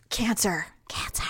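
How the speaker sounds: hushed stage whisper. Pretend. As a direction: whisper it like a taboo word. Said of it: "I'm gonna whisper it".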